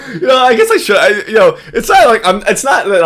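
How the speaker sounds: talking through loud, high laughter; can barely get the words out for laughing; laughing too hard to finish. Real, not acted.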